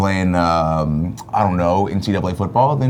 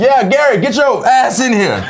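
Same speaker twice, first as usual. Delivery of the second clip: high, loud mock-aggressive summons; scary aggressive call; 9 semitones higher, 3 dB louder than usual. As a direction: bark it like a threat. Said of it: "They said it in a scary, aggressive way".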